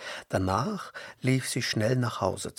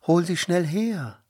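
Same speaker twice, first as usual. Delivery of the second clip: higher and louder, eager; urgent plea; 6 semitones higher, 4 dB louder than usual. A narrator's reading. Princess urging Miriam to fetch the woman, eager. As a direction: ask her to hurry. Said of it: "bat die Königstochter".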